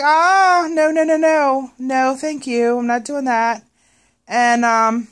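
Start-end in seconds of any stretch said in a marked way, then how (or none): 0.0-3.6 high, loud, alarmed no-no-no; shocked refusal. Retold or imitated. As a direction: recoil at the price, loud and high. Retold shock at high total.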